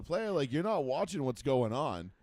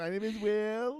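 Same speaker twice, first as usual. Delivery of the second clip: slow, high put-on voice; hammed-up concussed player; mock dazed player; 8 semitones higher, slower than usual. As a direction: drag out the answer in a silly higher pitch. Mimicking the dazed player's answer.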